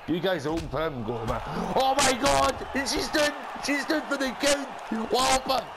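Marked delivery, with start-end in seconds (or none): 1.8-5.7 too excited. Real, not acted. Gamer yelling as the opponent is done for the count, overexcited. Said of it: "I got a bit too excited there".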